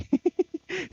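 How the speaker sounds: sudden high-pitched laugh; high burst of laughter. Real, not acted. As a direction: let out a bright squeal of a laugh. Laughs at own joke.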